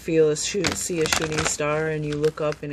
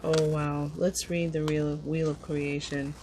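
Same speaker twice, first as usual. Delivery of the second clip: normal talking voice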